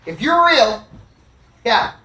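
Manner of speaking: retold yell, partly held back; yelling at the sky. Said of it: "I'm yelling at the sky".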